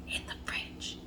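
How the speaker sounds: breathy secret whisper; whispered aside. Real, not acted. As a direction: let it slip as a hushed secret. Secret tip aside to viewers.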